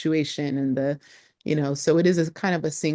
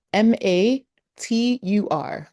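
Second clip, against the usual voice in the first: ordinary talk, nothing marked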